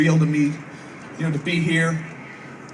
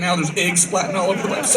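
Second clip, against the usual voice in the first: normal talking voice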